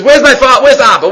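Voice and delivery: loud searching call, pitched high; loud call looking for father. Acted. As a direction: call out for your father across a crowd. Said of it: "He's calling his father Abba".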